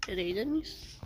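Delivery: hushed unvoiced aside; whisper. Real, not acted. Gamer noticing the trader is gone, whispered aside. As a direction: whisper it to yourself.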